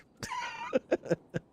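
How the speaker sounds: squeaky high-pitched laugh. Real, not acted. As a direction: burst into a high giggle. Laughs at own joke.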